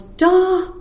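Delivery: put-on high toddler call; baby's Da. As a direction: point and call it like a baby. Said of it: "All this baby could say was".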